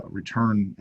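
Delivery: normal talking voice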